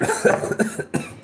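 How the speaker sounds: spluttering cough from the heat; choking cough after spicy food; harsh cough. Real, not acted. Coughing after hot sauce.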